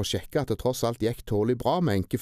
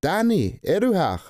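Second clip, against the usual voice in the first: high, loud, hopeful call; 8 semitones higher, 5 dB louder than usual. A narrator's reading. Tina calling out to find her dog Danny. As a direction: call across the room to him.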